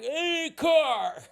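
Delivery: high, loud mock announcement; hammed-up shout at the car; mock bouncer call. Bouncer dumping drunks at the car, hammed-up.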